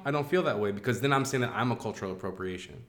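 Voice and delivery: ordinary talk, nothing marked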